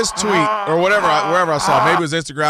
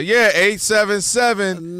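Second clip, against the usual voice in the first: slow, drawn-out phone number read; slowly said; 2 semitones higher, slower than usual. Shown as a demonstration. Host slowly reading the call-in number to listeners. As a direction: stretch each number out. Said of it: "Let me slowly say".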